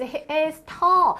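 very slow, drawn out "he is tall"; slow model sentence. Shown as a demonstration. Teacher modeling the sentence for students, very slow. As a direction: lay each word out slowly for students.

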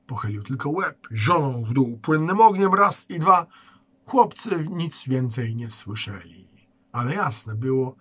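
plain reading voice

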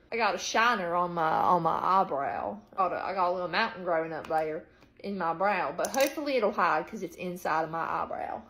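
put-on casual, countrified Southern accent. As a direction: slip into a playful Southern twang. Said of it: "I'm sorry about the southern accent".

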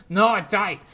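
sudden loud cry of surprise; excited shout. Real, not acted. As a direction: yell it out as the unit falls. His unit dies mid-battle.

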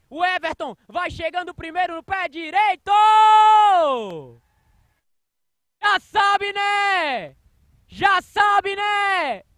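high, loud goal shout; explosive goal commentary. Real, not acted. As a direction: scream the goal call at full force. Goal is scored live.

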